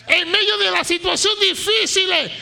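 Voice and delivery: fiery sermon climax; preaching at full force. Acted. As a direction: thunder it to the congregation.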